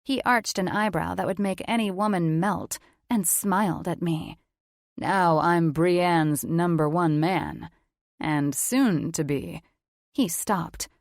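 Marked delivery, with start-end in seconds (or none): 5.0-9.6 lowered, unhurried male voice, assured; smooth slow confident man. Acted. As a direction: lay claim to her, calm and slow.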